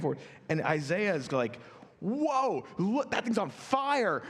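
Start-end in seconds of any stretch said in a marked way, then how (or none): 2.0-4.2 amazed. Retold or imitated. Isaiah sees the fiery vision.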